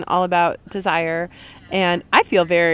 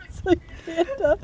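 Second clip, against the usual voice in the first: high voice breaking up with laughter; helpless amusement; 11 semitones higher, 3 dB quieter than usual. Real, not acted. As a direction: squeeze it out through giggles. Laughing over the name mix-up.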